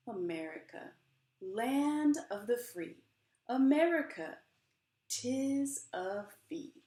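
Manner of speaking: slow, swelling spoken-word performance of a poem; dramatic poem reading. Acted. Author reads her book to the audience, impassioned poem reading. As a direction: perform the poem with rising passion.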